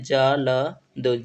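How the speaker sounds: जल्द broken slowly into ja, la, da; slow dictation of जल्द, each letter-sound separate; sounded out for writing. Shown as a demonstration. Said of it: "ठीक से लिखते हैं".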